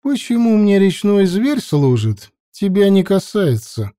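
high and unbothered; unfazed. A narrator's reading. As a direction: brush him off calmly. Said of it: "не смущаясь".

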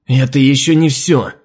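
loud, slow and furious. Acted. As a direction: explode in rage. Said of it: "взъярилась она".

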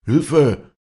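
loud, high cry for help; involuntary scream. A narrator's reading. Vinzenz, pushed to the railing, screams for help loudly. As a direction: shout it in sudden alarm. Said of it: "Schrie Vinzenz unwillkürlich auf".

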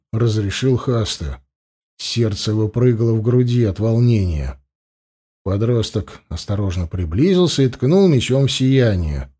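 usual speaking voice

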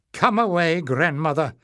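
usual speaking voice

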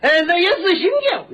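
Shandong accent. Shown as a demonstration. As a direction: imitate a Shandong man saying zhang as jiang. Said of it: "山东话怎么说呢".

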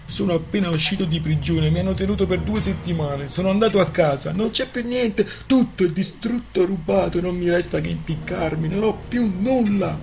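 high-pitched, whining despair; acted weepy outpouring of misfortunes; tearful lament. A narrator's reading. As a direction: plead as if in tears, rising to desperation. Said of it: "si mise di nuovo a piangere".

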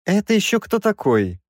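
high-pitched, sour question; disdainful professor. A narrator's reading. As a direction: ask it with a high-voiced wince. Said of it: "скривился Краевский".

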